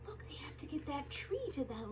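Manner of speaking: hushed whisper building suspense; storyteller's secret whisper; suspenseful whisper. Acted. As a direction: whisper to pull them in.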